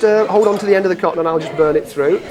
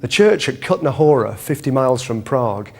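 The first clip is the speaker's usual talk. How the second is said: documentary narration; 6 semitones lower than usual. Acted.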